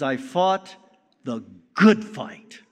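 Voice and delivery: good punched slow and loud; the good fight, with good stretched and loud; stress on good. Shown as a demonstration. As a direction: lean hard on the word good. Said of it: "I would emphasize the word good".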